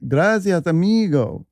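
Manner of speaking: high, singsong Mexican accent. Shown as a demonstration. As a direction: put on a hammed-up Mexican Spanish accent. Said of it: "How do you say "gracias" with the accent".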